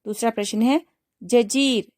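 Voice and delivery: ordinary talk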